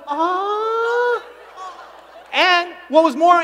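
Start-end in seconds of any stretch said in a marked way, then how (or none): 0.1-1.2 high, soft, sighed ah. Shown as a demonstration. Speaker demonstrates the sisters' sighed ah, high. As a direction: sigh it out sweetly and high. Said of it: "The ah, it was like".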